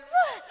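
high, loud mock burst; emotional outburst. Preacher mimics gushing people walking in, emotional outburst. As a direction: blurt it out as feelings spill over. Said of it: "Their emotions are just pouring".

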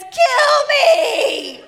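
high, loud hammed-up wail with a laugh; dramatic queen. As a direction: throw yourself down and shout it like a drama queen. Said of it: "in true dramatic queen fashion".

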